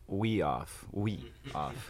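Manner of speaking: playful hint of a French accent; mock French accent. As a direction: lightly put on a French accent. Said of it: "you guys have a French accent".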